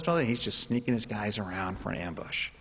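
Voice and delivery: ordinary talk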